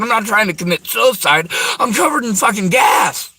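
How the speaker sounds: reliving the terror, shouting; frantic shout. Real, not acted. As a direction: yell it out in panic. Panicked retelling of gas danger.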